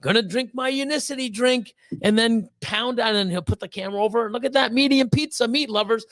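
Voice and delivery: high, pumped-up mock boast; bragging buddy. Buddy bragging about pounding a pizza, hammed-up boast. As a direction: ham up a cocky guy bragging on camera. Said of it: "I see him, like".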